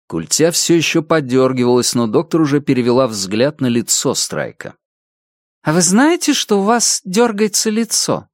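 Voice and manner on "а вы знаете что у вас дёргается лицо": brisk questioning doctor; female doctor voice. Acted.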